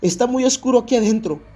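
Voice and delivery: high-pitched panicked pleading; acted desperate cry; retold screaming. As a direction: reenact a trapped girl begging to get out. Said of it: "Candace grita".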